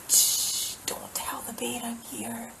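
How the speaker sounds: mock secretive shush; hiding whisper. Imitating someone hiding from a bear, whispering. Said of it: "you want bears to be like".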